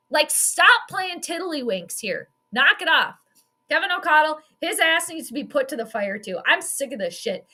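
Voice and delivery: ordinary delivery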